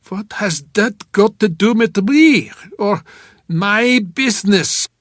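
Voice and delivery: loud, high, indignant protest; puzzled outrage. A narrator's reading. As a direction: demand it in baffled outrage. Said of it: "His puzzled outrage".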